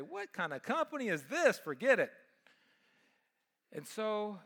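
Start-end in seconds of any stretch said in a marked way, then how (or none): 0.0-2.1 imagined buyer scoffing. Retold or imitated. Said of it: "the buyers would say".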